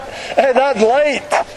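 usual speaking voice

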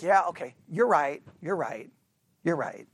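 low, soft placating agreement; insincere agreement. Retold or imitated. Husband answering his griping wife, insincerely agreeing. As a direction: give in just to end the griping. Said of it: "you don't really mean they're right".

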